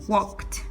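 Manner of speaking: walked as "wokt"; one-syllable walked with a T ending; final T sound. Shown as a demonstration. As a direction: end walked on a released "kt". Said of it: "entonces voy a pronunciarlo".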